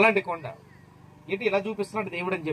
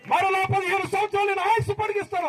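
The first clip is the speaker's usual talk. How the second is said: very high-pitched preacher's cry; impassioned pulpit declaration; fervent proclamation. Retold or imitated. Preacher proclaims God's promise.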